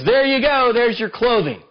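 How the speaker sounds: a touch of furious contempt; angry taunt. Retold or imitated. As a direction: throw the clothes at them. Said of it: "he's really mad".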